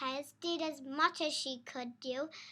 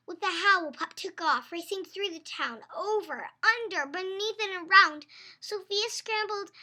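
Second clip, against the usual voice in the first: building, higher and louder chase; excited; 4 semitones higher, 10 dB louder than usual. Acted. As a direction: race faster into the chaos.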